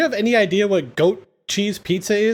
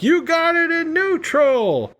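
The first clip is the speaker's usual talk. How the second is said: high, slow mock shout from the boat; imitating a boat driver yelling; 11 semitones higher, slower than usual. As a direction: call it out high and drawn, half-joking. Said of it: "Roman's like".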